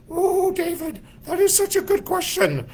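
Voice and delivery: put-on very high, thin Indian-accented voice of a tiny old guru; Maharishi's Indian accent. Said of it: "a very heavy accent, and he would go".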